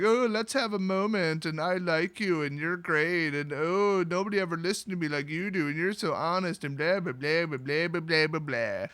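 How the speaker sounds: singsong, sappy mockery; mock touchy-feely. Mocking characters having a touchy-feely moment, singsong sappy. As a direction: trail off into dismissive blah-blahs. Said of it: "the touchy-feelingness of this".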